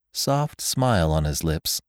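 plain reading voice

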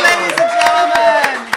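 announcer. Acted. Host announcing the guest.

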